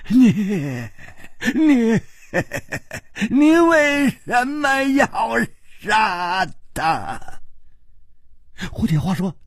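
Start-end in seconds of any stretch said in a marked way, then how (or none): 0.1-7.2 high, slow, stammering roar. Acted. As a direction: roar the accusation, dragging out the words. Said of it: "大声地吼叫着说". (大声吼叫着质问)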